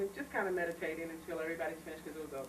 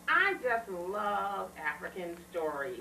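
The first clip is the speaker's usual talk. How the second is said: slow retold line; 4 dB louder, much slower than usual. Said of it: "she said".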